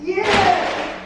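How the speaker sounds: high, loud squeal of joy; excited cheer. Real, not acted. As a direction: cheer like you just won. Rug delivery just arrived.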